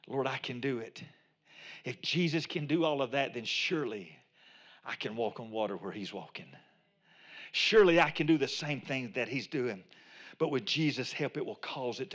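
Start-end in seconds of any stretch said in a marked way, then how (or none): 0.1-9.8 quiet. Acted. Voicing Peter reasoning with Jesus.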